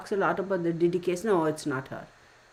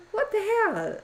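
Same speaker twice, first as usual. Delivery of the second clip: high, incredulous what the hell; shocked exclaim. Retold or imitated. Recalling her reaction to the fake-accent video, high shocked exclaim. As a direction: blurt it out in disbelief. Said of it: "And I was like".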